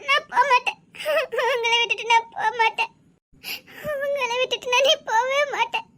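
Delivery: tearful insistence; weeping. Acted. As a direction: refuse to leave through tears. Wife weeping, refusing to leave.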